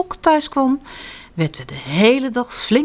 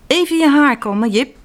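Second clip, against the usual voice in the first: mother voice; 6 semitones higher, 4 dB louder than usual. Acted. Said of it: "zegt moeder".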